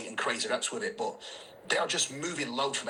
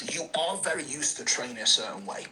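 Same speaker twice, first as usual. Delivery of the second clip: ordinary delivery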